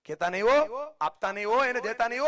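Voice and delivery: a hammed-up neighbour spreading word not to help; society gossip warning everyone off. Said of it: "આખી સોસાયટીમાં કહી દઈએ".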